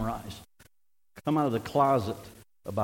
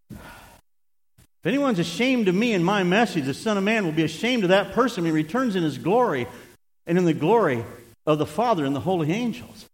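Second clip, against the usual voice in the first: raised, ringing preacher's reading; emphatic scripture reading; 5 semitones higher, 4 dB louder than usual. Real, not acted. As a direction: proclaim the verse so nobody can miss it. Emphatic re-reading after misprint joke.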